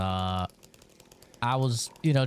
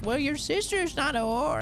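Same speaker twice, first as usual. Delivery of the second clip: high shocked scolding voice; mock-prim rebuke, pitched up; mimicking scolding mom. Mom scolding son for rude remark, high and shocked. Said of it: "She's like".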